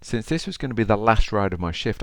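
ordinary delivery

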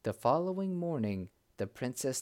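ordinary delivery, nothing marked

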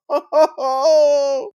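high helpless laugh; giggling at the disaster; burst of laughter. Real, not acted. Laughing at own failure.

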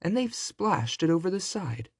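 lightly voiced, put-out mama bear; mother bear. A narrator's reading. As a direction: pitch it up as a middle-sized bear. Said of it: "said the mother bear in her middle-sized voice".

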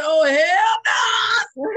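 half-laughing drawn-out refusal of the nurse; slow laughing hell no. Retold or imitated. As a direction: stretch out the hell no while cracking up. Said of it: "I said".

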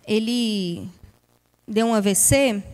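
usual speaking voice

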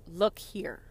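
ordinary talk

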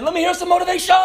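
high, loud drill sergeant bark; drill instructor. Retold or imitated. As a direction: demand motivation from the squad. Instructor calling on the squad.

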